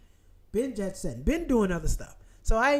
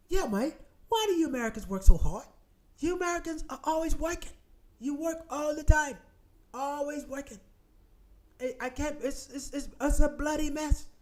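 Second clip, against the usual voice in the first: higher-pitched mock English accent; rusty English accent; 5 semitones higher than usual. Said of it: "my English accent has deteriorated".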